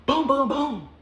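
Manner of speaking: loud mock banging on the door; playful knock sounds; imitated knocking. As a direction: pound it out like fists on a door.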